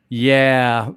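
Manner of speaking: drawn-out "yeah" filling a pause; mimicked tour guide's long filler; imitating tour guide. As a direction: stretch it out as an awkward space filler. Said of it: "she would go".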